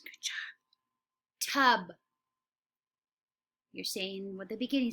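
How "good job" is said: soft whispered good job; whispered praise. Real, not acted. Teacher praising little kids in a whisper. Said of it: "whispered praise to class".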